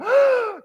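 high, sharp gasp of shock; mock shocked gasp. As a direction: gasp the way the flustered women did. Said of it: "and they went".

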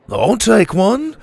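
put-on a boy jumping at food; eager kid. A narrator's reading. Dante grabs a tamale.

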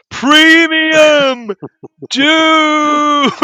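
high, drawn-out stoner Snake voice; Snake from The Simpsons. Retold or imitated. Mocking a film character for not sounding like Snake. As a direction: stretch it out like Snake the crook. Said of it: "doesn't sound like Snake from The Simpsons".

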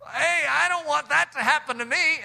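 high-pitched acted protest; mock alarm, pitch jumping high; fearful slave.

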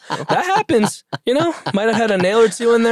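high, fast laughing aside; amused high quick quip; laughing joke. Real, not acted. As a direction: toss it off laughing. Joking about a nail board.

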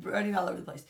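ordinary talk

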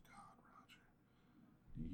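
soft slow unvoiced whisper. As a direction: breathe the name in a hush. Reading a text lovingly.

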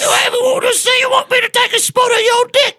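shrieking, very high mock British; British accent. Crude offer to co-host, screeched fake British accent. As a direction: shout it in a piercingly high, silly British voice. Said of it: "Can you do a British accent?".

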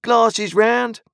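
loud, high call across the room. A narrator's reading. The stranger calls to the landlord for glasses, loud.

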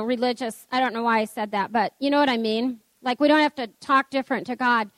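ordinary delivery

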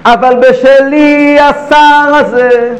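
ordinary talk

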